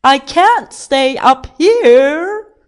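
loud, high, slow wail; distressed shout. A narrator's reading. King stuck on top pleads, shouting. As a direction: yell it with every word stretched. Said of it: "he shouted".

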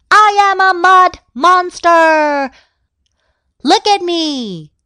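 high, loud triumphant announcement; monster shout. A narrator's reading. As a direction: shout it to scare everyone. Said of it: "she shouted".

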